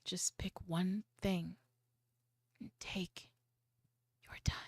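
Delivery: soft, slow whisper; slow chill whisper. Shown as a demonstration. Teacher urging students to go slow, whispered. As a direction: hush it down to calm the listener. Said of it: "real slow and real chill".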